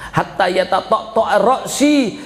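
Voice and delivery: preacher intoning Arabic to the congregation; preached Arabic. Acted. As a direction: call it out like a preacher. Arabic quote in sermon.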